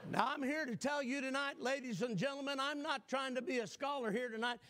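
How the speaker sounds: preacher building to a fervent close; building sermon. Acted. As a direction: drive it home to the congregation. Sermon climax.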